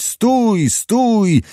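high called-out stop from afar; distant shouting. A narrator's reading. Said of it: "poczęli machać chorągwią i krzyczeć".

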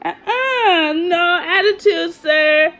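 high, loud pretend telling-off of a baby; playful mom reprimand, half laughing; mock scolding baby. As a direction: scold the baby in fun, squeaky and firm.

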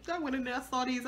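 normal talking voice

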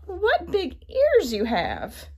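put-on high wondering girl voice; little girl voice. A narrator's reading. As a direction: innocent surprised child. Girl addresses disguised wolf.

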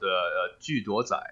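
"Ju Duo Zai" detto con i toni e i suoni del mandarino; Mandarin pronunciation. Shown as a demonstration. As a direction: say "Ju Duo Zai" the way a native Mandarin speaker would. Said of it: "the Chinese pronunciation of the tea is called".

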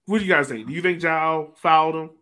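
ordinary delivery

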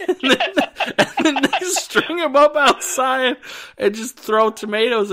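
high, loud telling through laughter; laughing. Real, not acted. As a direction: crack up while telling the absurd story. Cracking up retelling an absurd scene.

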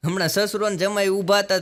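usual speaking voice

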